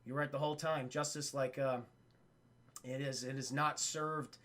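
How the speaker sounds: low, hushed movie dialogue; mock-solemn quiet. Investigator conceding to the prisoner, mock-solemn and quiet. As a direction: play it soft and grave. Acting solemn confession in cell.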